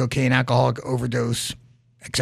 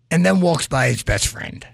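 ordinary talk, nothing marked

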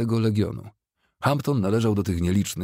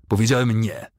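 louder, firm prohibition; raised-voice command; stern order; 4 semitones higher, 4 dB louder than usual. A narrator's reading. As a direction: insist forcefully, falling on the last word. Charles stops the raised sabre.

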